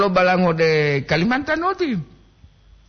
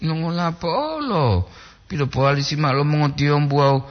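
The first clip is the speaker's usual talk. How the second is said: ordinary talk, nothing marked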